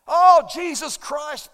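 loud, high, drawn-out exclamation; slow, exasperated shout; imitated angry outburst. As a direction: mimic a man yelling out at a game. Imitates a man blaspheming loudly at softball.

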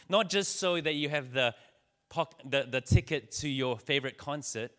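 impassioned sermon at full force; preaching. Acted. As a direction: drive the message home to the congregation.

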